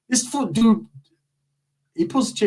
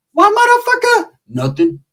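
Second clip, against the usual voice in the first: much higher, louder squeak of a threat; high caricature challenge; 6 dB louder than usual. Retold or imitated. Shorty squaring up to Cheetah, high mock threat. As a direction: snap back in a high put-on voice. Acted quote, high put-on voice.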